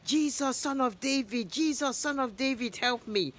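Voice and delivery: a beggar crying out high for help; blind man shouting. A narrator's reading. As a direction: call out desperately over the crowd. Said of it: "he starts shouting".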